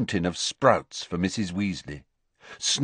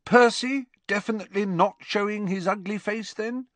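twin voice. Acted. Twin jabbing about Percy.

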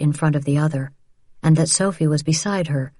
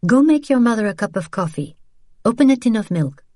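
a firm, higher instruction; 6 semitones higher, faster than usual. A narrator's reading. Isabel sending Sophie off to make coffee, firm. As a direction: give the order kindly but with no room to argue. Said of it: "Isabel said firmly".

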